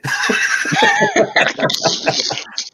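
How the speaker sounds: high breathy giggle; dying laugh. Pretend. Dying prankster laughing at his own joke. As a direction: cackle as you fade out. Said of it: "then I'll just laugh and fade away".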